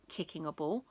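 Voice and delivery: usual speaking voice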